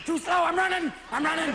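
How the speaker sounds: high, panicky rush; frantic golfer. Retold or imitated.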